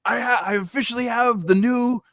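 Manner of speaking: excited high-pitched DJ announcing a premiere; hammed-up on-air announcement; radio DJ. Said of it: "the guy's like".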